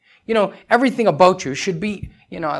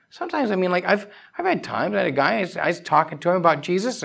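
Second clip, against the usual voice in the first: usual speaking voice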